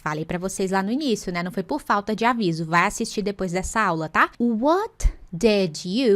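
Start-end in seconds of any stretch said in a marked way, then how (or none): 4.4-6.1 very slow, each word stretched; slow demo. Shown as a demonstration. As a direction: stretch out "What did you" word by word. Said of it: "foque nessas three words". ("What did you" bem devagar, palavra por palavra)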